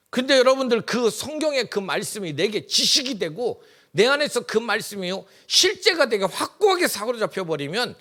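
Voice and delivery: 높고 크게 외치는 설교 톤; 회중을 향해 힘차게 선포하듯; 열정적인 설교. Acted. Preaches at full force. (성도들에게 믿음을 촉구하는 힘찬 설교)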